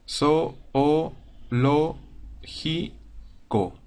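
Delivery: zoológico broken into separate syllables So, o, lo, ji, co; each syllable said apart with a pause after it; split into syllables. Shown as a demonstration. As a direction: spell it out syllable by syllable, So-o-lo-ji-co. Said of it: "es separar las sílabas de la, de la palabra".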